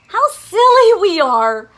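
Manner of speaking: loud laughing exclamation. Acted. Squirrels laughing in relief.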